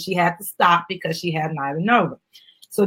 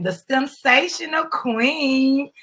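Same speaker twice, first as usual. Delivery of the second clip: stretched-out, regal announcement; queenly self-introduction, drawn out; grand title; 6 semitones higher, much slower than usual. Acted. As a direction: proclaim the title slowly and grandly.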